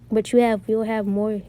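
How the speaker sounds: ordinary delivery, nothing marked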